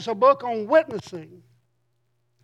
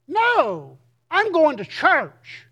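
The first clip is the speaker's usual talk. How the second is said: higher and louder, slow, fervent reply; with some zeal; 6 semitones higher, 6 dB louder, much slower than usual. Retold or imitated. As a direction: answer him with eager zeal, slowing on the last words. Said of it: "I kinda said it with some zeal".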